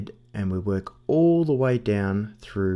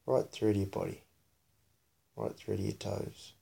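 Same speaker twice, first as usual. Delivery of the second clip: soft, quiet meditation voice; hushed, soothing body-scan guidance; guided meditation; 8 dB quieter than usual. Real, not acted. Leads child's guided meditation.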